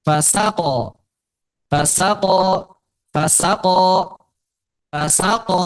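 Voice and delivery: fa-SA-ko, middle syllable brought out; stress on second syllable. Shown as a demonstration. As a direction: repeat fasako, lifting the middle syllable sa each time. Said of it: "Jadi sini yang tinggikan".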